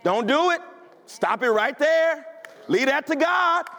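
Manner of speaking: normal talking voice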